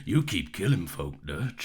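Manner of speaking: low gruff cowboy; Arthur Morgan. Acted. Co-host joining in the cowboy impression, low and gruff. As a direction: growl it low like an outlaw. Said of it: "basically just Arthur Morgan".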